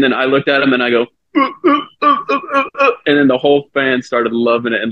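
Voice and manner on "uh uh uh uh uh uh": very high string of stuttered grunts; mocking stammer. Pitcher mimicking the tongue-tied heckler, mocking stammer. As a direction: mimic someone's stuttering back at them. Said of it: "fucking mumbling".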